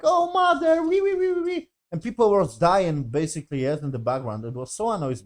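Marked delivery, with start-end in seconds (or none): 0.0-1.7 high squeaky mock whimper of a child crying for mother; mock whiny Tio. Reviewer mocking Tio calling for his mother, high mock wail. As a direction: whine it like a bratty kid, mocking. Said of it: "the Tio was like".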